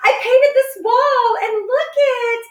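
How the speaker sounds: high-pitched, drawn-out fake excitement; mock gushing. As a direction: parody a show-off flaunting home projects. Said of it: "people that have to constantly show".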